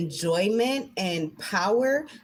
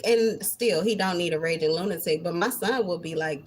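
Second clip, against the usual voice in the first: ordinary delivery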